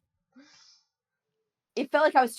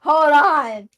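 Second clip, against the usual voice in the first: loud, eager and pitched up; excited exclamation; 4 semitones higher, 9 dB louder than usual. Retold or imitated. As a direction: burst in with a bright idea. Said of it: "She, she's like".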